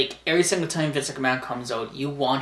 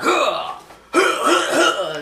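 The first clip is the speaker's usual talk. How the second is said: mock grunts; 13 semitones higher, 6 dB louder, slower than usual. Boss grabbing the crutch.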